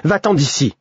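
curt, cutting order to leave; brutal dismissal. A narrator's reading. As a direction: throw him out with one harsh word. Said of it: "me dit-elle brutalement".

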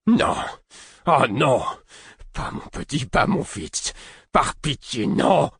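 high, anguished cries of no, breaking to a whisper; anguished pleading. Acted. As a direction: beg as if your son is dying. Old man sees his boy dying. (supplication déchirante, aiguë et désespérée)

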